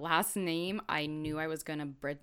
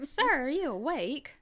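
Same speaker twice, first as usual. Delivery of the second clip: put-on old-timey detective; 6 semitones higher than usual. Mocking cheesy reenactment detectives.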